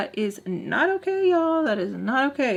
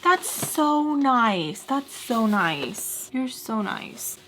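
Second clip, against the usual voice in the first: very drawn-out fawning imitation; syrupy mockery of a fawning friend; mock gushing; 2 semitones higher, much slower than usual. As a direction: gush slowly, stretching every word. Mocking friend gushing over money.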